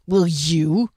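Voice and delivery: growling challenge; dwarf's menacing growl. A narrator's reading. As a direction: growl the question at a rival. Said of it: "he growled".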